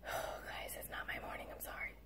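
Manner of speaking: whispered apology to the class; tired whispered aside. Real, not acted. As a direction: breathe it out as a whisper. Apologizing to class after a sigh.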